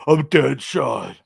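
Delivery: slow, drawn-out mock villain. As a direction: drag the words out like a cartoon villain.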